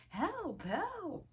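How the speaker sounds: very high mock cries for help; screaming. A narrator's reading. Reader voicing a boy crying for rescue, mock screaming. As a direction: squeal for help, hammed-up, without much volume. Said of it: "Eddie screamed".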